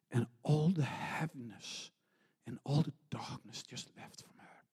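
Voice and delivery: breathy whispered hush as darkness leaves. Acted. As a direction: drop to a whisper, awed. Tender climax of healing story.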